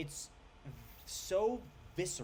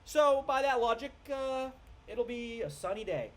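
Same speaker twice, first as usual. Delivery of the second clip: higher and louder, slow, goofy mockery; dopey mock voice; 8 semitones higher, 3 dB louder than usual. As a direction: ham up the clueless guy's sunny logic. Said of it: "And he's like".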